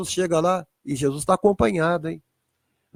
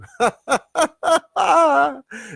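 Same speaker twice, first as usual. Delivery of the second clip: burst of laughter; 10 semitones higher than usual. Real, not acted.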